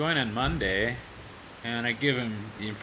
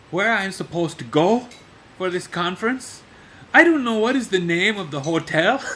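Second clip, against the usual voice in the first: much higher, louder, half-laughing angry boss; mock angry phone rant; 7 semitones higher, 8 dB louder than usual. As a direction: imitate him ranting down the phone. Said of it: "he's all angry on the phone".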